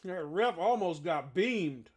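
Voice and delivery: loud, slow amazed call. Real, not acted. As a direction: stretch it out in disbelief. Ref nearly hit by the ball.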